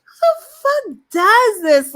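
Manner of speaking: outraged outburst. Real, not acted. Outraged at a tweet.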